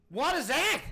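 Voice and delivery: loud, high burst of disbelief; exasperated outburst. Real, not acted. Reacting to an official's empty answer, exasperated outburst. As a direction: burst out, baffled and fed up.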